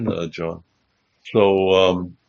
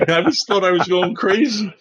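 normal talking voice

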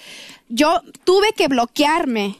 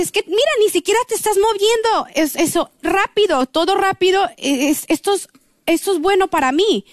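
quoting the doctor, pitched much higher, gushing; doctora entusiasmada; 6 semitones higher than usual. Retold or imitated. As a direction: play the doctor's eager praise, voice up high. Doctora elogia a la paciente. (imitando a la doctora, entusiasmada y con voz mucho más aguda)

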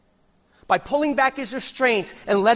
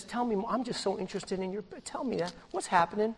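softer, intimate coworker voice; the handsome coworker, soft and attentive; coaxing coworker; 11 dB quieter, faster than usual. Pretend. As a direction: lean in and coax her confidences.

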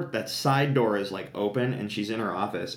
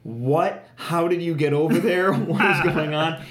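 louder, higher, acted bewilderment; imitated startled disbelief, pitched up; baffled astonishment; 7 semitones higher, 5 dB louder than usual. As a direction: play someone caught totally off guard, laughing through it. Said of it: "turns around with this look like".

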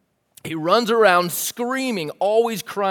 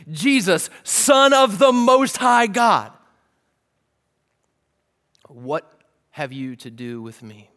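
demon's cry; 5 dB louder, slower than usual. Retold or imitated. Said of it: "answering the question".

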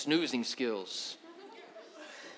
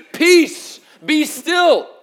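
high, loud, ringing command; commanding call; 11 semitones higher, 12 dB louder, much slower than usual. Retold or imitated. Jesus commanding the sea, loud and ringing. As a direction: call out across the sea with authority. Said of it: "he said to the sea".

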